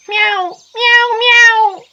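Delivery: string of high, loud mews; cat meows. A narrator's reading. Golden cat to the boy, meowing. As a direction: mew insistently like a cat. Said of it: "said the golden cat".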